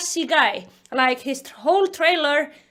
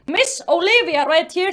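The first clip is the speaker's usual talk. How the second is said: ordinary talk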